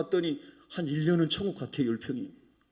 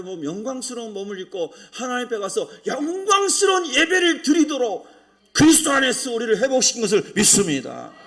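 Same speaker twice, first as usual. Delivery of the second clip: preaching that rises higher and louder; building sermon climax; 8 semitones higher, 9 dB louder than usual. Real, not acted. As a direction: build to a loud declaration of faith.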